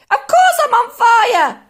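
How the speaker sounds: high, very loud retort; indignant yell. A narrator's reading. Grandma answering George about the fire, screeching retort. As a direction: yell it back at full pitch. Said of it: "she yelled".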